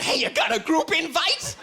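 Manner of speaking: high, strained begging; desperate addict. Acted. Addict begging a stranger for an invite, desperate and high. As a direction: beg for it like a frantic junkie.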